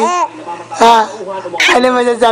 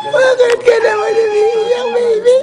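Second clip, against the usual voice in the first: high mock-crying baby voice; 10 semitones higher, slower than usual. Parent asks crying baby.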